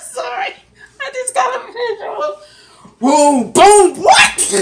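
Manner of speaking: high, loud helpless laughter breaking through words; cracking up and can't stop; squealing with laughter; laughing hard. Real, not acted. Host cracking up reading comment.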